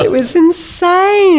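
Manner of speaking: high and slow through laughter; laughing. Real, not acted. Telling the host about the stunt, laughing. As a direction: still laughing it out.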